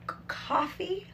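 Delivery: breathy timid whisper of coffee; whispered try. Real, not acted. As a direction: whisper the word unsure. Tentative whispered retry.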